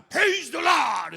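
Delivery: a joyful American pastor hollering; shouting praise. Retold or imitated. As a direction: belt it like a revival preacher. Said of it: "E ele começou a dançar e gritar".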